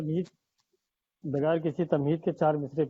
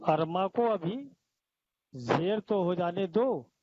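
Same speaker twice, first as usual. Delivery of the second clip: poetry recitation. Acted. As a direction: linger on each line for the audience. S2 recites verses at mushaira.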